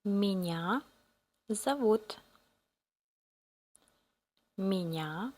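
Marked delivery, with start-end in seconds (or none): none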